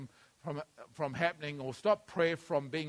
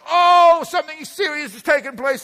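much higher and louder, a hammed-up wail; mock panicked cry; 9 semitones higher, 12 dB louder than usual. Preacher mimics believers crying out after trouble, hammed-up wail. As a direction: cry out like someone suddenly desperate. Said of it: "I'm crying before you now".

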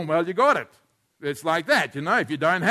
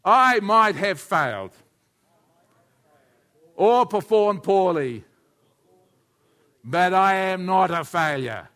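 loud, slow group recitation; the whole room repeating the pastor's words; congregation echo; 5 semitones higher, 9 dB louder, slower than usual. Real, not acted. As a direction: say it together slowly and firmly. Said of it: "everybody say that with me".

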